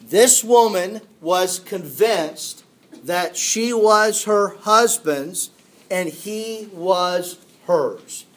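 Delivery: ordinary delivery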